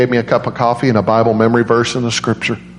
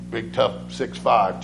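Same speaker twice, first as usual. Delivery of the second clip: ordinary talk, nothing marked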